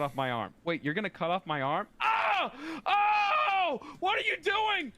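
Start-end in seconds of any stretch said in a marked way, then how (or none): 2.0-4.9 high alarmed cry; shocked shout. Acted. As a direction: yelp in mock alarm. Acted shock at stabbing.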